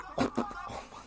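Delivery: high, squeaky burst of laughter; real laughter. Real, not acted. Viewer cracking up at the fight footage, real laughter. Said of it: "I'm sorry for laughing".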